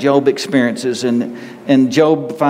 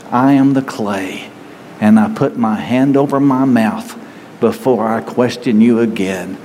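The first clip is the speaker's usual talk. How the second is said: closing prayer. Acted.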